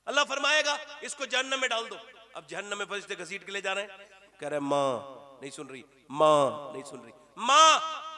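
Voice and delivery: tearful high-pitched sermon telling of Judgment Day; wailing sermon. Acted. High wailing retelling of the dream.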